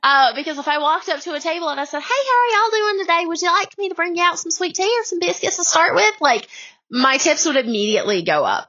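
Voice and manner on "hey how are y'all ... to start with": Southern server voice. Retold or imitated. Server charming tourist tables in Southern accent. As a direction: put on a sweet Southern accent to win tips. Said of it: "was the voice".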